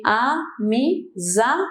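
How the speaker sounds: "amizade" split into four slow beats, a-mi-za-de; syllable by syllable. Shown as a demonstration. Teacher giving students an example, slow syllable-by-syllable delivery. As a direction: spell out "amizade" one syllable at a time. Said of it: "a minha sílaba mais forte é o za".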